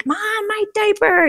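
put-on high little-kid voice; toddler voice. Son silently asks mom for a diaper change, in a high toddler voice. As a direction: squeak it like a toddler asking. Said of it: "he's thinking to me".

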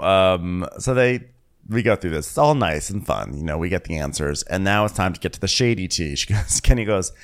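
ordinary delivery

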